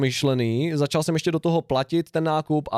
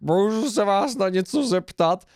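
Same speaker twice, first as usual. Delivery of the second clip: imitating a strange old woman, higher and drawn out; weird old-lady voice; 4 semitones higher, slower than usual. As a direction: put on an odd, slow pestering voice. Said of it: "fakt jako takovým jako divným hlasem". (divný, vyšší, protáhlý hlas staré paní)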